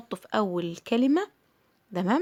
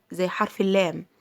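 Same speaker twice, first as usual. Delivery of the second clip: usual speaking voice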